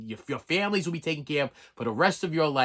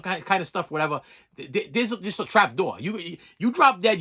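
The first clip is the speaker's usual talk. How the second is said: ordinary talk, nothing marked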